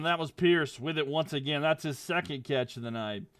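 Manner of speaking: normal talking voice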